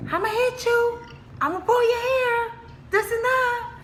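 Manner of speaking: very high mock child voice; high-pitched mimicry of bickering children; imitating kids fighting. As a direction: squeal threats like squabbling siblings. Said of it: "I'm listening, fighting".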